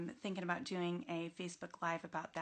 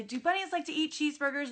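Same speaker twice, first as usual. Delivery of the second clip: playful question; 8 semitones higher, 5 dB louder than usual. Retold or imitated. Teacher asks kids playful question.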